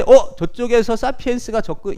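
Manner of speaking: high, loud warning to the group; mock alarm call. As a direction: call out as a lookout spotting someone. Acting out hominid warning others.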